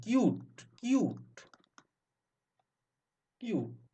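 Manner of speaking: "কিউট" with a long yoo, repeated separately; stretched "yoo" sound. Shown as a demonstration. Teacher showing U as yoo, long vowel in "কিউট". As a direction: draw out the ইউ inside "কিউট". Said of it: "ইউ".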